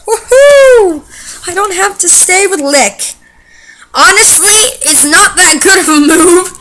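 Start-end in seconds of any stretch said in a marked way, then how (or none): 0.1-1.0 loud, high woohoo; excited cheer. Real, not acted. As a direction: cheer with real glee. Cheer over a new move.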